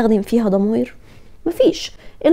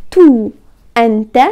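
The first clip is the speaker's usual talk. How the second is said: ordinary delivery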